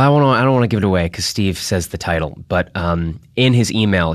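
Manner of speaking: usual speaking voice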